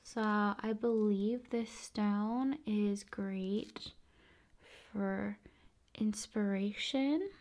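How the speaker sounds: usual speaking voice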